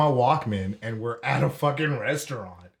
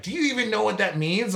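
high-pitched mock scolding; challenging mom; 9 semitones higher than usual. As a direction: imitate a mother demanding an answer. Mom challenging, retold quarrel.

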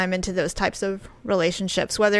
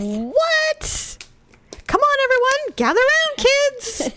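put-on kids' TV host; slower than usual. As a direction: call the kids over like a show host. Mock kids' show host.

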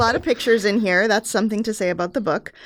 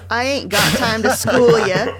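a hammed-up Baltimore accent, a bit louder; 4 dB louder than usual. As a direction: put on a mock Baltimore accent to read grandpa. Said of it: "Can you read it in a Baltimore accent?".

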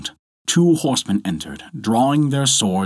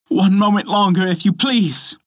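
high, louder plea; pleading wife; 11 semitones higher, 4 dB louder than usual. A narrator's reading. Wife stalling her furious husband, pleading. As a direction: beg urgently in a raised pitch.

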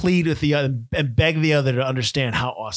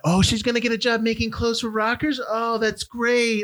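sarcastic; 6 semitones higher than usual. Real, not acted. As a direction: sneer at the plot with fake delight. Mocking the movie's plot.

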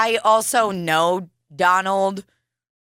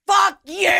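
retold angry shout, pitched way up; mock shout; 11 semitones higher than usual. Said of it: "You're like".

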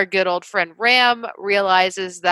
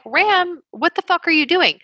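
exasperated; 8 semitones higher than usual. Retold or imitated. Exasperated viewer yelling at character.

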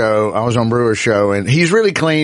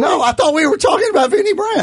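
flustered outburst. Real, not acted. Host realizes he trashed the wrong man, flustered higher protest. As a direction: blurt it out, mortified at the mix-up.

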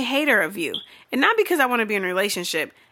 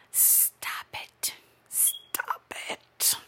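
hissed, drawn-out whisper with long s; exasperated whisper; 12 dB quieter, much slower than usual. Pretend. Pleading with lovey couples, exasperated whisper. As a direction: whisper it slowly, at the end of patience.

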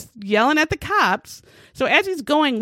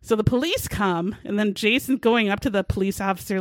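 ordinary delivery

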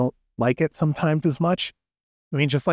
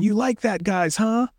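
mock wrestler taunt; 8 semitones higher than usual. Wrestler mugging to the crowd.

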